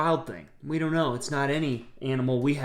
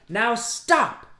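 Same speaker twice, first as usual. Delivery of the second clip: firm command; 6 semitones higher, 4 dB louder than usual. Acted. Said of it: "Max said".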